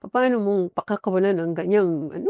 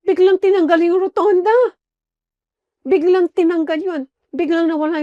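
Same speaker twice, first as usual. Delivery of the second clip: higher, louder amazed telling; excited; 6 semitones higher, 5 dB louder than usual. Real, not acted. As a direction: marvel at the sudden change. Excited testimony of miracle.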